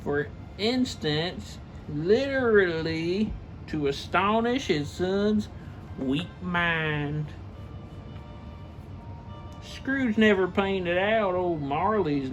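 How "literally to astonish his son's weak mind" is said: plain reading voice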